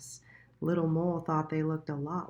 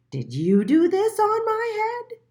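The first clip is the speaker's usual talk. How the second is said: high, loud squeaky question; a small indignant mole demanding answers; little mole voice; 9 dB louder than usual. A narrator's reading. Little Mole asking the pigeon, high and indignant.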